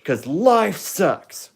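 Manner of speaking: loud, high, slow hype-host shout; overexcited YouTuber announcing a prize; MrBeast impression. Shown as a demonstration. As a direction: ham it up like a giveaway host. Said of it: "It's my first Mister Beast impression".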